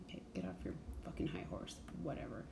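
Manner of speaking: a quick, breathless, scoffing under-the-breath jab; scornful mutter. Real, not acted. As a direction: brush him off in a fast whisper. Scornful aside at the character.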